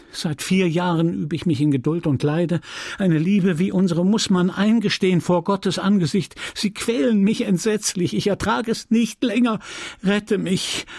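high, pleading, overwrought lover; desperate Emma. Acted. Emma begs Rodolphe to rescue her, rising desperate plea. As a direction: beg him to save you, voice climbing.

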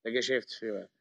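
normal talking voice

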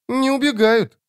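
put-on high child voice, faint surprise; Жемчуг, a touch surprised. A narrator's reading. Demon watching the assassins stand firm, childlike surprise. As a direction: demon child mildly taken aback. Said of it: "проговорил Жемчуг с ноткой удивления".

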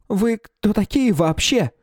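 high voice cracking from fear; squeaky cracked voice. A narrator's reading. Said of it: "от страха пустил петуха".

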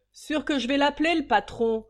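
rapide, plus haut et fort; crié. Acted. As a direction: menace scandalisée. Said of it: "cria le garçon". (garçon scandalisé crie vite)

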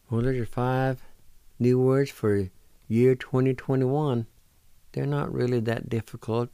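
normal talking voice

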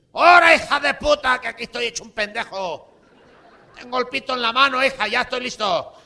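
high, loud shouting; loud high-pitched rant. Retold or imitated. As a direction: yell at the screen, demanding action. Uncle yelling at the TV.

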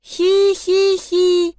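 high, slow monotone "hee-hee-hee"; eerie toy giggle. A narrator's reading. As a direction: giggle like a spooky toy, drawn out. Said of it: "безостановочно издавала Лолита". (жуткое протяжное хихиканье)

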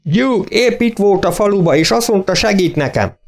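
high, lightly voiced farmer calling through the glass; worried farmer shouting through the car window, high. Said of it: "az ablaküvegen kiabált be".

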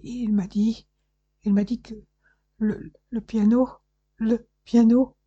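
terrified daughter stammering to her father; stammering toneless voice. A narrator's reading. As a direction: stutter it out in terror, barely voiced. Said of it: "balbutia ... d'une voix sans timbre".

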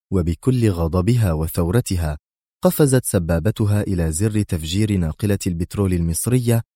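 usual speaking voice

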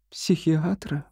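high, hushed questioning «Психиатра?»; shocked echo. A narrator's reading. Wife repeats doctor's suggestion, shocked. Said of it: "Этель потрясённо поднесла ладони".

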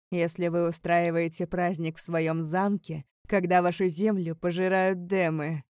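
loud, scornful accusation; accusing. A narrator's reading. Chained Alaric challenging the prince, loudly accusing. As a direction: throw the charge at the prince.

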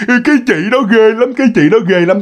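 high, whining child imitation; imitated small child, still scared; crying toddler. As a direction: mimic a tearful toddler complaining. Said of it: "khóc một chút xíu xong nó nói".

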